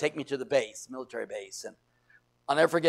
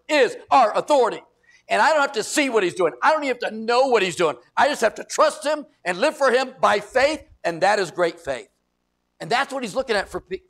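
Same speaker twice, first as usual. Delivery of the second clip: loud, high, emphatic sermon delivery; full-force pulpit exhortation; fiery preaching; 7 semitones higher, 7 dB louder than usual. Real, not acted. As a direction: drive each phrase home to the congregation.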